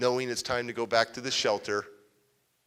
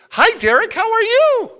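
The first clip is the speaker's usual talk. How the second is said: much higher and louder, beaming hello; overly happy greeting; 12 dB louder than usual. Retold or imitated. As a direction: greet the kid like the happiest man alive. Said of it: "And he's so happy".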